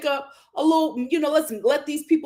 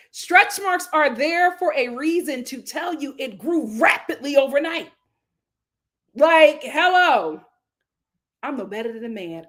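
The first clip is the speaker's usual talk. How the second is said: normal talking voice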